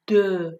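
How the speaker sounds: دو said as deux, vowel between o and e; careful French "deux" for دو; drawn-out French eu. Shown as a demonstration. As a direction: hold the rounded eu in دو. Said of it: "بین او و ا و اما یه مقدار کشیده تر".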